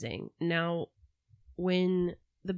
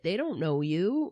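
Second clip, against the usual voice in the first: normal talking voice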